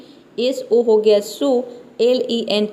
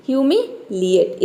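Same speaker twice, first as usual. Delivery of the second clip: slow model word; slower than usual. Shown as a demonstration. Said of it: "इस तरह से आपको pronounce करना है".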